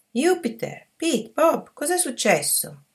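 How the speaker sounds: high calling voice across the street; aunt calls to the boys from the top of the street, pitched high. Acted.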